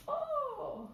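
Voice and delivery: very high "Oh!" then soft, drawn "ah là là"; amazed wonder. Pretend. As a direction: marvel at it for the children. Pretend amazement at find. (émerveillement joué)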